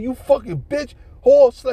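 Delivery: high, loud imitation of hurled insults; retold name-calling. Angry man calling his woman names, imitated name-calling. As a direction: act out him cursing her out. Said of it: "He was calling all kind of names".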